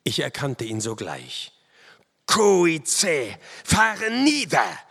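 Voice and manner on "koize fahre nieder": high, loud, drawn-out war cry; furious shout. A narrator's reading. Narrator striking down the chief, high furious shout. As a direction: roar it at the enemy chief. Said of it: "rief ich ihm zu".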